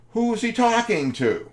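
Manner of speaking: loud, very high-pitched exasperated question; flustered. Retold or imitated. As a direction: burst out high and loud, acting at wit's end. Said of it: "I'm getting a little flustered".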